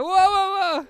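high, urgent calling out to stop him; alarmed whoa. Retold or imitated. Retelling grandpa stopping his sleepwalking son at the fridge, alarmed calling. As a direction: grandpa rushes in to halt the boy, urgent. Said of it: "My grandpa was like".

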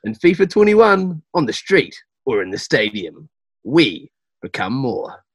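measured game promo voiceover, drawn-out ending; ad read. Pretend. Voice actor reading the script for the studio, measured ad read. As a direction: read it like a slow TV slogan. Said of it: "do you want me to go cool".

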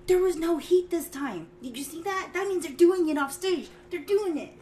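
high, loud, frantic Chandler rant; agitated rant. Retold or imitated. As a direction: panic and insist, pitch climbing.

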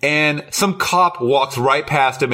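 normal talking voice

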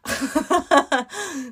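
high mock belly laugh; imitated laugh. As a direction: laugh like a big toothy creature. Imitates Totoro's big grin.

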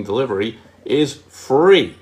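slow delivery, free falling and firm; ad-style slow delivery is free; stress on free. Shown as a demonstration. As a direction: linger and land on free. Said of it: "the most powerful word".

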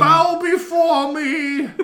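high, loud, slow regal command; queen command. Pretend. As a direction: drag it out like a haughty queen. Mock royal command.

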